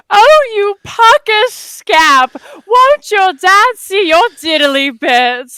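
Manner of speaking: high, loud, drawn-out mock-prim scolding; Marjorie. Church friend teasing the group, hammed-up prim scolding. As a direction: ham up a quaint, scandalized church lady. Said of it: "Marjorie said to us".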